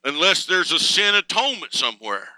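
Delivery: ringing revival-style reading; quoting a preacher. Retold or imitated. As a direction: deliver the quote like a fervent preacher. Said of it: "he says".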